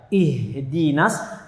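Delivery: very slow "ihdinas", breath running on the H; drawn-out "ihdinas" with airy H; flowing H. Shown as a demonstration. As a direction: let the H of "ihdinas" flow before "di". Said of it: "haknya dialirkan".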